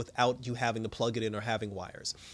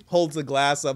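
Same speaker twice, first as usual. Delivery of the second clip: usual speaking voice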